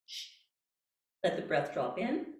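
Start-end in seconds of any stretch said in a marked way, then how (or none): 0.1-0.3 short unvoiced shh, very soft; a breathy little shh to empty the lungs; a hushed diaphragm bounce shh; tiny shush. Shown as a demonstration. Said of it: "And a little shush".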